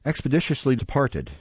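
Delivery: ordinary delivery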